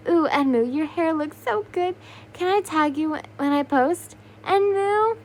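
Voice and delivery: high, loud fawning fangirl; gushing fangirl. Acted. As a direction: fawn over him like a fan. Fangirl fawning over him.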